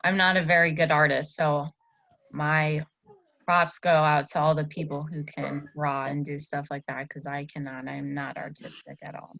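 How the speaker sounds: ordinary delivery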